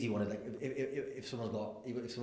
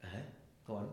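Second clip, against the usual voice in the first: mock suspicious surprise; 6 dB quieter, much faster than usual. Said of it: "I went".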